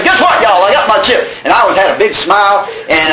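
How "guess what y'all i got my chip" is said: high, eager call to the room; excited announcement. Acted. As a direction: burst out with proud good news. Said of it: "I was excited about getting that".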